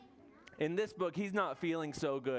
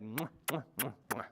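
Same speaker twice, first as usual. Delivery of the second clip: four little smacked mwahs; pretend kisses; 4 semitones lower than usual. Bear kisses each sick friend, pretend kiss sounds. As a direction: plant quick kisses one by one. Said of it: "he kisses each head".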